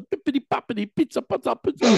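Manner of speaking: hammed-up cartoon Italian accent; mock Italian. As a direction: rattle it off like Peter Griffin doing Italian. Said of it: "do the Italian one".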